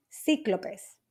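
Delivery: "cíclopes" stressed on the first syllable, CÍ; teacher's model "cíclopes", first syllable stressed. Shown as a demonstration. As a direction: put the accent on the í of "cíclopes". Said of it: "con acento en la I".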